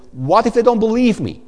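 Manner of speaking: Moses' anxious excuse. Acted. Preacher voicing Moses doubting God, high and anxious. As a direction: whine it like a worried excuse. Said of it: "the third excuse that Moses bring".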